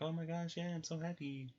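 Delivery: high, gushing mock-happy voice; happy Persephone. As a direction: squeal it like an overjoyed daughter. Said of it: "she's like".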